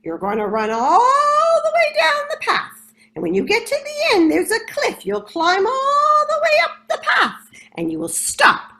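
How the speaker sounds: loud, high squawking parrot call. Acted. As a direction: call them out like a bossy parrot. Parrot explaining race route.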